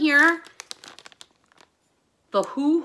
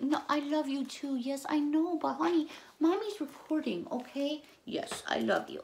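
higher, hushed coaxing voice; soft gentle aside; 4 semitones higher, 7 dB quieter than usual. Real, not acted. Mommy telling honey she's recording, soft gentle aside. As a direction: soothe someone who wants your lap, softly. Soothing an interrupting pet or child.

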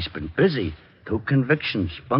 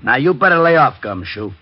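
louder, higher warning to back off; angry warning; 5 semitones higher, 5 dB louder than usual. Acted. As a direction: bark it as a threat. Sergeant warns the detective.

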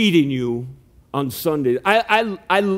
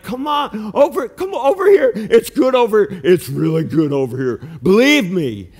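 higher, louder coaxing to the sheep; coaxing call; 4 semitones higher, 3 dB louder than usual. Retold or imitated. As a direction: lure the sheep over, high and eager. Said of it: "you're like".

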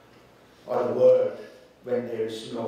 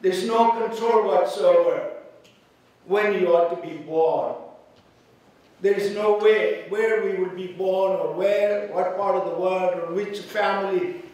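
higher, louder preaching; 7 semitones higher, 6 dB louder than usual. Acted. Pastor appealing to those without hope, forceful. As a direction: press the point hard.

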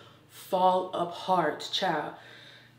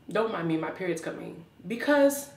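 ordinary delivery

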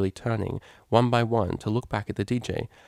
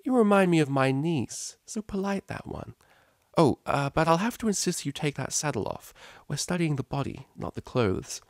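higher, kindly teacher voice; friendly teacher; 7 semitones higher than usual. Acted. Teacher welcoming the cellist model, lightly voiced friendly mare. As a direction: greet them with easygoing warmth.

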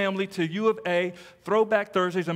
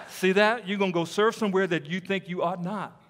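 ordinary talk, nothing marked

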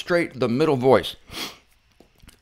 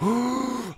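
mock gasp; 8 semitones higher, 5 dB quieter than usual. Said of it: "Everybody's like".

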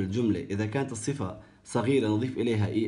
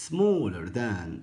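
slow drill; much slower than usual. Shown as a demonstration. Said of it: "teacher drilling comparative form".

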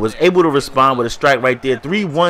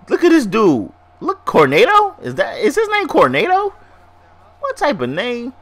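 amused disbelief; 11 semitones higher than usual. Real, not acted. Amused at the batter's odd name.

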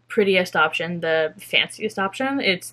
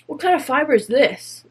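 pretend street interviewer; 5 semitones higher than usual. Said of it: "go to people in public and be like".

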